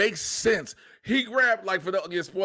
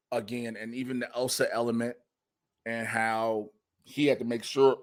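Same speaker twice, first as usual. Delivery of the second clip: ordinary delivery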